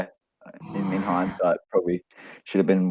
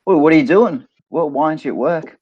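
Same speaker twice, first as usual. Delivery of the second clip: higher, louder startled wife; mock alarmed wife; 7 semitones higher, 4 dB louder than usual. Wife in the joke finding husband on the couch, alarmed. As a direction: play the wife catching him home early. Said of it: "she's like".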